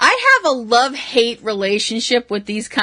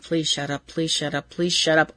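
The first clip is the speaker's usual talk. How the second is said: soft, low, weary begging; a quiet, exasperated plea; mock pleading; 6 semitones lower, 7 dB quieter than usual. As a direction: beg under your breath for him to stop. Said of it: "I just have that feeling like".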